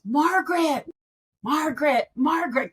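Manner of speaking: high, loud call across a noisy room; repeated high call, loud, then easing off; calling out a name. Retold or imitated. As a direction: call her name to get her attention over the crowd.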